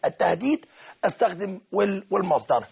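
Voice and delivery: usual speaking voice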